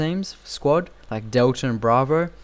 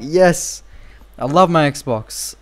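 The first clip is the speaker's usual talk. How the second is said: loud, high, drawn-out celebration; excited cheer; 4 semitones higher, 5 dB louder, much slower than usual. Retold or imitated. Gamer relives getting the Xbox beta, in an excited cheer. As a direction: cheer it out slowly and proudly.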